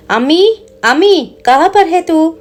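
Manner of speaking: calling out high to mom in Hindi; a friend's high call to her mother. Retold or imitated. Friend calls her mother from the hall, high call. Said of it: "అని పిలుస్తుంది".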